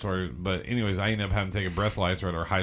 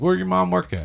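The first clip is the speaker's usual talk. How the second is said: higher, louder cop voice; cop's question; 9 semitones higher, 5 dB louder than usual. Retold or imitated. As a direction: press like a suspicious cop. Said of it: "He's like".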